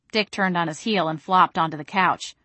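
usual speaking voice

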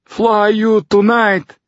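put-on high, slow Russian-accented broken English; strained high voice with a thick Russian accent. A narrator's reading. Fyodor tells Tucker about the flight, in Russian-accented broken English. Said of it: "added in badly broken English".

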